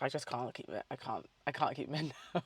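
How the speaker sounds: ordinary delivery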